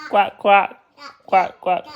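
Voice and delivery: short playful quacks; duck quack. Pretend. Dad showing his child a duck sound, playful quacking. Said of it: "What about a duck sound?".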